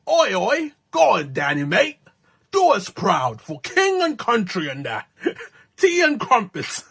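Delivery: cheeky mock London accent; hammed-up English accent. London resident cheering on a countryman, mock English accent. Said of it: "if you think my English accent is flawless".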